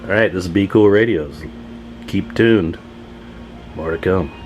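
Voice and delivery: ordinary delivery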